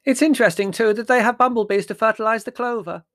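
very high, loud, oblivious aunt; piping eccentric old aunt; dotty aunt, brightly interested. Acted. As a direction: sail in loudly on a tangent.

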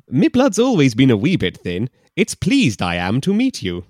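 loud mock brogue with Yoda-like order; hammy Scot, odd word order; Scottish man. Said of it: "Is it Yoda?".

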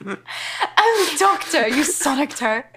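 put-on slow, drawn-out David Tennant accent; mock David Tennant accent. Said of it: "Why are we doing that dialect?".

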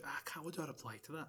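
hushed, at a loss, replayed; helpless half-whisper. Storyteller replaying how he asked friends for help, helpless half-whisper. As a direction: drop to a near-whisper, imitating your stuck self.

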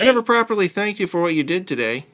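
put-on high, quick girlfriend voice; high-pitched, brisk female character; girlfriend's voice. A narrator's reading. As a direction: pitch it well up for the girl's line. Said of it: "I got my voices mixed up".

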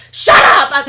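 sudden loud, high yell; mock scream. Boyfriend breaking off to scream at the dog, loud mock yell. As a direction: scream it at a dog behind the door. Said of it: "And screams at the door".

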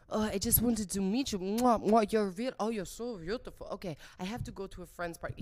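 imitating a gushing Frenchman with air kisses; French accent. French date greeting her by his car, French accent. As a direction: put on a smooching French suitor's accent.

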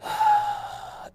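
high gruff pirate noise; pirate growl. Acted. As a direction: let out a hammy pirate arrr. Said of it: "I just use my pirate voice".

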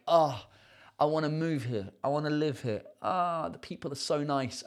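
soft, sighing imitation of himself yearning; wistful longing. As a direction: say it softly, as if dreaming aloud. Retelling his longing on visits back.

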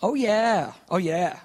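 high loud first, then dropping back; wry mock cheer. Pastor revealing he soaked his computer bag, wry exclamation. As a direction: crow it ruefully over your own blunder. Wry reaction to spilling the water.

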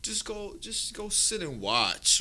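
drawn-out, hesitant brush-off; dismissive church lady. Retold or imitated. Church director sidelines a child, slow mock brush-off. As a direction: imitate an offhand dismissal, slowly.

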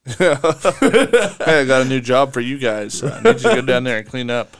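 laughing, booming mock decree to his men; mock king's order. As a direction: bellow the order like a smug king. Mock king orders the accusers.

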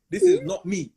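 high, loud denial; loud protest. Real, not acted. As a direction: protest it loudly. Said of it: "repeated denial".

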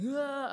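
high, loud mock wail; short loud burst of mock bawling; imitated wail. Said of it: "his wail started getting louder".

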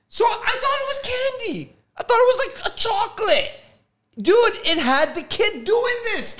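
high and loud, worked up; excited telling. Real, not acted. Speaker to friend about the milk can, high and excited. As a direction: insist on it with rising excitement.

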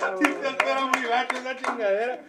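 words through real laughter; laughing. Real, not acted. Comedian laughing at his own punchline. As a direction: laugh while saying it.

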